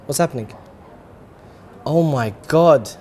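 normal talking voice

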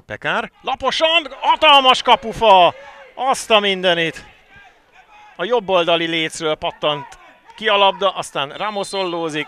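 loud, high burst at a post hit; excited Hungarian sports commentary. Real, not acted. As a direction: call the near goal at full pitch. Shot hits the post.

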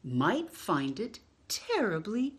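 lively picture-book read-aloud with long pauses; reading to children. Acted. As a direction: read it like story time for little ones.

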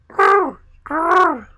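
loud, very high trilled bird call; shrilly rolled pigeon coo; wood pigeon cooing. A narrator's reading. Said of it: "said the wood pigeons".

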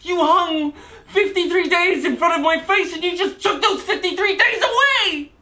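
building to a high-pitched, angry outcry; mounting anger. Acted. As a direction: let pent-up frustration climb into shouted accusation. Defendant's anger builds at the court.

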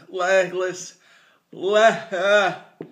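loud, high and strained; stumbling over words in pain; shaking with effort. Real, not acted. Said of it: "I can't even talk".

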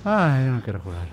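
usual speaking voice

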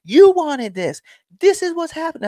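very high-pitched, goading callout; mocking taunt. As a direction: rub it in to an angry parent. Taunting the parent at ringside.

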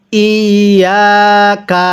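very slow, drawn-out ইয়াকা; model recitation. Shown as a demonstration. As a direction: hold the doubled ya in ইয়াকা on a level tone. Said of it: "আমার সাথে পড়ুন".

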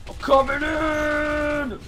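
high, loud, drawn-out yell; excited shout. Real, not acted. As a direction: yell it while charging in. Charging into fight.

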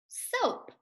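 blended word. Shown as a demonstration. Teacher revealing the blended word to students, singsong. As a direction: sing the blended word up high. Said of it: "make the sounds".

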